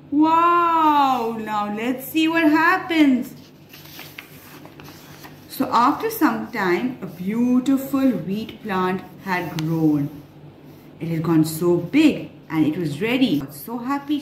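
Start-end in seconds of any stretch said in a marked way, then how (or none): none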